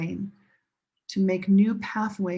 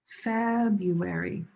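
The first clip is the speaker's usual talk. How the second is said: "February" slowly, with FEB drawn out; first-syllable stress; slower than usual. Shown as a demonstration. Teacher modelling stress for students, "February" as slow FEEEB-uary. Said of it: "Where's the stress?".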